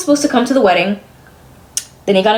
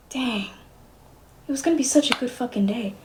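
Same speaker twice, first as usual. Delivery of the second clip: 1 deflated murmur; 11 dB quieter than usual. Real, not acted. Deflated after news.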